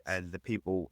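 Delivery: ordinary talk, nothing marked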